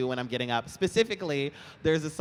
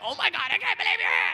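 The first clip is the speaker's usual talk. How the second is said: high, fast, louder fan squeal; excited fan; 11 semitones higher, 6 dB louder, faster than usual. Retold or imitated. Imitating the fan shrieking at him. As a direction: rush it out, starstruck. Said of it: "He's like".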